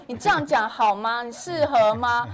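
loud, mock-offended comeback; indignant retort. Wife snapping back at teasing husband, loud and indignant. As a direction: fire it back at him, offended.

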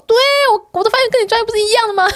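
提高嗓门、尖声地反驳司机; 理直气壮地顶回去，又高又响; 不服气的反问. Retold or imitated. 与司机争辩发音. (提高音调不服气地质问司机)

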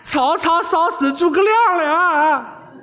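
high, slow, drawn-out shrieks for help; screaming for help. Acted. Burned boy screams to the princess for help, high panicked shriek. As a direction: wail it like a boy set on fire. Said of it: "大叫".

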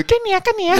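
very high squeaky parrot. Pretend.

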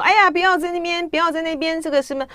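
high-pitched, scoffing imitation of online carping; sour sniping. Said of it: "就在那边酸说".

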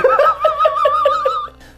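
squealing high laugh. Real, not acted. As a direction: let out a shrill giggle. Guest laughs at own joke.

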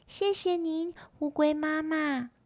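high, soft tadpole voice; polite little tadpoles. Acted. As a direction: thank her in a small, high-pitched voice.

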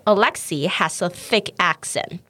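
slow, clear "Alexei has a thick accent"; teacher reading slowly; slow model. Shown as a demonstration. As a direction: say it slowly for the learner. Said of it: "我再念一次".

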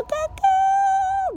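squeaky falsetto, drawn out slowly; very high voice. Shown as a demonstration. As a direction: show tight muscles with a high held note. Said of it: "你把肌肉拉高了".

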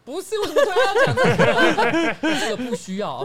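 high, loud, laughing disbelief; laughing protest. Real, not acted. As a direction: burst out laughing while objecting. Sudden awkward topic, laughing.

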